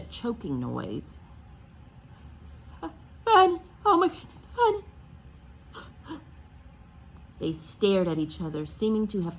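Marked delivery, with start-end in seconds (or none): 3.2-6.2 high, loud cry of alarm; horrified wail for her husband; shocked cry. Acted. Aunt Petunia to her husband, a high horrified cry.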